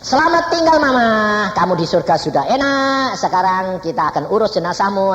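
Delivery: high, lightly sung farewell; farewell to mother. Retold or imitated. Son bidding farewell to his dead mother, high. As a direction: say goodbye sweetly to the departed. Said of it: "ngomong sama mamah".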